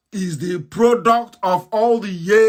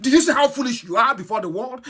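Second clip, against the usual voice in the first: ordinary talk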